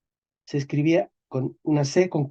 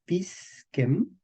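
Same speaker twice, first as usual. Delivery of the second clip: "pisquem" slowly split, pis then quem; syllable by syllable; 3 semitones higher, 6 dB quieter than usual. Shown as a demonstration. Teacher sounds out word for students, "pisquem" slow and divided. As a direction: pause between the two syllables of "pisquem".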